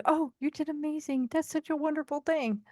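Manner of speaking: high, soft, sugary fake praise; fake cheerleader gush. Mocking a cheerleader's fake praise to a friend. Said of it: "get that fake".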